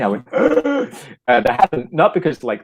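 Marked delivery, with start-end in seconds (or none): 0.3-0.9 sharp, high-pitched gasp; reenacted gasp. Playgoer reacting to the play, reenacted high gasp. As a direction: gasp aloud, then a high grunt. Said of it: "what I felt like for me".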